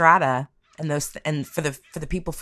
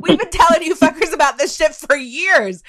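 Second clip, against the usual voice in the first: high, louder gloating told-you-so; smug vindicated loner; 10 semitones higher, 5 dB louder than usual. Retold or imitated. Paranoid shut-ins gloating at the world, high smug crowing. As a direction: crow like a paranoid loner finally proven right. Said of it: "these people were like".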